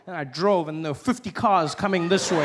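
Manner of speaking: normal talking voice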